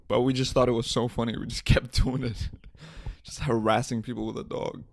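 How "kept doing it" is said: ordinary talk, nothing marked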